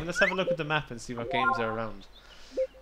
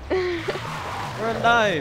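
relieved cheer; 8 semitones higher, much slower than usual. Real, not acted. Relieved after crash.

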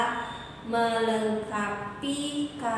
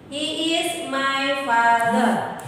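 slow, careful dialogue line; a teacher reading the dialogue line slowly; slow model sentence; slower than usual. Shown as a demonstration. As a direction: read it slowly for the class. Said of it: "dengarkan dialognya ya".

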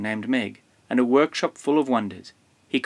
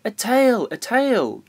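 high, excited exclamation; storybook cry, pitched high; 10 semitones higher, 4 dB louder, slower than usual. A narrator's reading. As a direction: call it out like an excited discovery. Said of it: "George cried".

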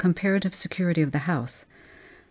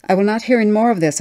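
fast, loud, furious outburst; angry shout; 5 semitones higher, 7 dB louder, faster than usual. Acted. Mother losing patience with her daughter, shouting fast and loud. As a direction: snap it out loud and quick, losing your temper. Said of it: "I shouted".

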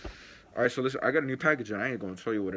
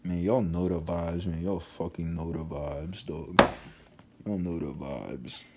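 smug satisfied; 6 semitones lower, slower than usual. Real, not acted. Vlogger telling fans the vibe, low, slow and satisfied. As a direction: let it sink in, satisfied.

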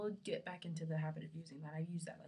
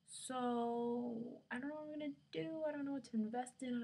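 helpless whine; 7 semitones higher than usual. Real, not acted. Voices helplessness over shampoo choice.

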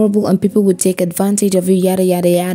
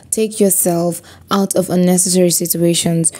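ordinary talk

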